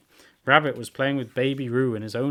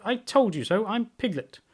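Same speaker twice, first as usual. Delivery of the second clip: Piglet; 11 semitones higher than usual. A narrator's reading. Piglet proved right to Kanga, high triumphant voice. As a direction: pipe up high, vindicated.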